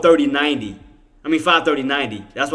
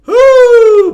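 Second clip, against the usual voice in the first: loud high whoop of hype; big excited whoo; 16 dB louder than usual. Real, not acted. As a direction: cheer it out at the top of the voice. Hyped about market contracts.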